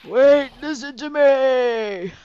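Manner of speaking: slow, loud pleading cry; desperate old man shout. Acted. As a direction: yell as you're dragged out. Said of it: "doing the voice of two old men".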